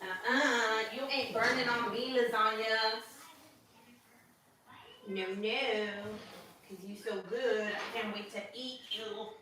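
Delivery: soft, distant off-mic chatter to the lasagna; talking to food. Real, not acted. Mom talking to her lasagna in the oven, softly playful. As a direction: coo softly at the dish from across the room.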